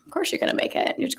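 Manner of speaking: whispered reassurance; whispered to herself. Real, not acted. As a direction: hush it as if only to yourself. Repeats it softly to herself.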